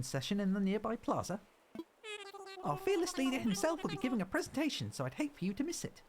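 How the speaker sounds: high-pitched helper voice; high cartoon announcer of island news; polite little game helper.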